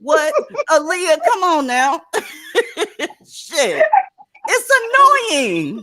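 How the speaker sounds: cracking up while complaining; exasperated laughing. Real, not acted. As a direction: laugh in frustrated disbelief.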